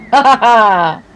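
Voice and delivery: ha ha ha that won't stop; helpless laughter. Acted. Big wheel to Dorothea, can't stop laughing. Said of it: "I can't stop laughing".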